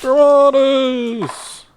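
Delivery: high, drawn-out triumphant cry; battle cry. Pretend. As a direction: belt it out long like a spell. Calls out the game's battle word.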